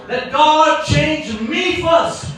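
slow emphatic proclamation. Real, not acted. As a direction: spell it out slowly like a command. Preacher slows key line.

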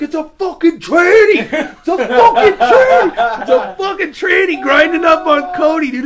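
drawn-out, high, full-volume mock outrage; outraged yelling. Storyteller imitating friend Cody at the pool party, outraged yell. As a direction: yell it high, stretching the words through laughter.

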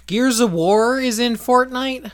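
high, loud disbelief; shocked shout of disbelief; surprised. Real, not acted. Surprised at news on Facebook.

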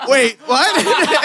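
high, laughing "Wait, what?"; shocked. Retold or imitated. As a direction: caught off guard, half laughing. Realizing directions gone.

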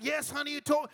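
high, loud insistence; loud wife's retort; wife shouting back. Retold or imitated. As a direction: yell back that he told her.

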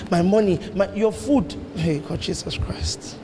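ordinary talk, nothing marked

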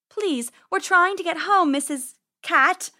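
high, loud little rabbit plea; anxious, high-pitched appeal; pleading. Acted. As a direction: plead politely with a dangerous cat. Rabbit pleads with menacing cat.

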